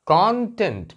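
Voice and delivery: Content as KON-tent, opening syllable punched; stress on first syllable. Shown as a demonstration. Teacher explaining what it contains, Content stressed on KON. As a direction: hit KON in Content, let tent fall. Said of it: "यहाँ stress दिया तो ये पढ़ा जाएगा content".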